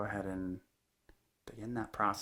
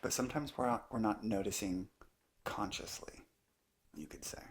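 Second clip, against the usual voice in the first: unhurried meditation teacher, long pauses between phrases; calm, measured guidance in short spaced phrases; guided meditation; 3 dB quieter than usual. Acted. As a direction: let each phrase settle into a long silence. Leads a guided meditation talk.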